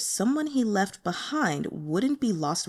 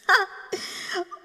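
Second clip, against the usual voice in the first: high giddy laugh; 12 semitones higher than usual. Acted. Fan reacting to sweet scene.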